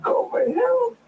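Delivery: high, strained begging, close to tears; desperate plea. Acted. Dying man begs Carlotta for help, high and desperate.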